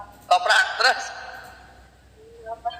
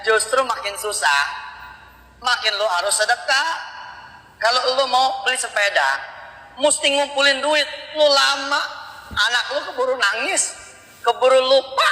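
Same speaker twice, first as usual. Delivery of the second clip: retold advice; 5 semitones higher than usual. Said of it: "Saya bilang sama dia".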